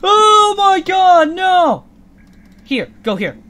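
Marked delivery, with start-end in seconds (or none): 0.0-1.9 loud, drawn-out panic cry; panicked yell. Real, not acted. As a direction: stretch it out in alarm. Chased by Undyne.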